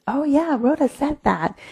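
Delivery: high-pitched voice of a student recalling; student suddenly remembering. Acted. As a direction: pipe up as if it just came back. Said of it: "when you hear the music".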